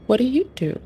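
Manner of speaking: playful high question to the menu; high silly voice. Pretend. Mock-asks the game setting.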